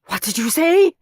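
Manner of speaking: high, loud rising question; shocked disbelief. A narrator's reading. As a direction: hit SAY in disbelief. Teacher realises the trick.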